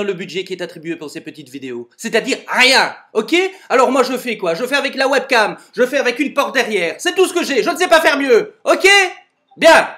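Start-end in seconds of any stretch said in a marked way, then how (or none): 2.0-8.9 rising, louder exasperated protest; exasperated rant. Acted. Dad snaps at Lolo about the budget, exasperated and loud. 9.6-9.9 one loud, high clipped word; curt shout. Acted. Dad ends his rant to Lolo with a sharp shout.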